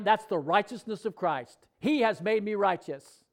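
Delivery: ordinary talk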